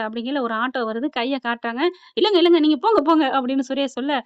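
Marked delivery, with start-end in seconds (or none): 2.2-3.2 higher, louder shooing; mock order. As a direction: wave the cousin off firmly. Suresh orders them away.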